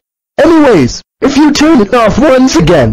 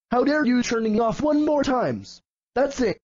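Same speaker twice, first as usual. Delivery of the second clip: ordinary talk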